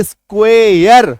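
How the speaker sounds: स्क्वेयर drawn out slowly, ending in yar; slow pronunciation demo. Shown as a demonstration. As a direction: say स्क्वेयर slowly with a falling end. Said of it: "तो उच्चारण देखिए क्या निकल के आ रहा है". (स्क्वेयर को धीरे-धीरे बोलें, अंत में यर)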